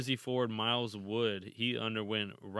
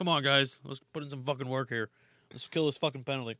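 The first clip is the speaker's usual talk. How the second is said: higher, fast hammed-up bench coach; mock coach; 4 semitones higher, 5 dB louder, faster than usual. Coach urging his players on the bench, mock fired up. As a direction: bark at the team like a riled coach.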